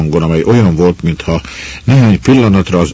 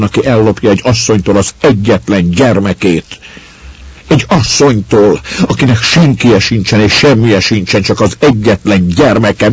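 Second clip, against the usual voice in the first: demanding fury; 5 semitones higher, 3 dB louder than usual. A narrator's reading. Mother demands judgement, building.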